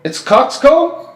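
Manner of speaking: high, drawn-out disbelief; incredulous question. Real, not acted. Reacting to learning the hat's name, incredulous. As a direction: voice leaping up in real surprise.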